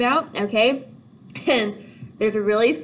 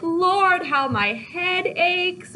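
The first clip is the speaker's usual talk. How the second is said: high, slow moaning complaint; whiny moaning; 9 semitones higher, much slower than usual. Acted. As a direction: moan dramatically about a headache. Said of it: "she's like".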